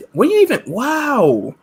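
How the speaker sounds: a sudden shocked cry; incredulous. Real, not acted. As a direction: react in disbelief at the number. Shocked at the fake card count.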